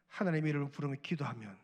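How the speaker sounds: usual speaking voice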